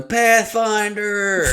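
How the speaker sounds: high, drawn-out warning yell. Retold or imitated. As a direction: stretch the shout out slowly. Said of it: "I hear Walter yelling".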